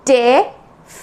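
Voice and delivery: definitely broken up as de, fi, net, lee; syllable by syllable. Shown as a demonstration. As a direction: separate each part of definitely with pauses. Teacher breaks word into syllables.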